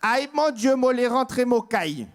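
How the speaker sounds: put-on exclamation plus haute et plus forte, accent antillais; s'emballer comme un Martiniquais flatté; accent créole enflammé. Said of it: "Et là, le Martiniquais". (accent antillais, exclamation aiguë et forte)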